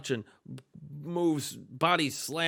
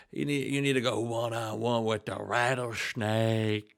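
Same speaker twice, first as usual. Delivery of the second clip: Stone Cold; 3 semitones lower than usual. Acted. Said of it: "why did I get in that accent".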